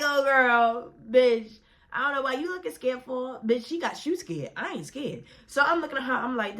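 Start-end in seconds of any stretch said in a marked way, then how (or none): 0.0-5.2 high-pitched teasing of a scared friend; mocking disbelief. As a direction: tease her in a high, incredulous voice. Mocking her scared friend after laughing.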